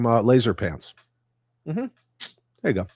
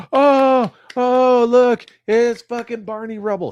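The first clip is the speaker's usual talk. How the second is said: high, loud, gushing mock fan voice; mocking fanboy squeal; 13 semitones higher, 8 dB louder than usual. Streamer mocks grown men gushing at a toy panel, squealing high. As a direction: ham up a grown man squealing over a toy. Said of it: "Everybody, like".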